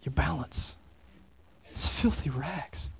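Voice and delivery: slow, hushed verdict; grim whisper. Real, not acted. Pastor whispers the verdict to the church, slow and hushed. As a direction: breathe it out like a sentence passed.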